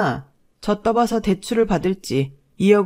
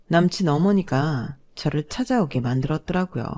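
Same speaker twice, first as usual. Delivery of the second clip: usual speaking voice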